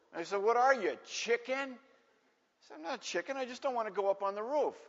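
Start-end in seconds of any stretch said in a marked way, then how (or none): 0.4-1.8 high-pitched schoolyard taunt. Retold or imitated. Bullies taunt the boy. 2.7-4.7 put-on protesting kid. Boy protests to taunting bullies.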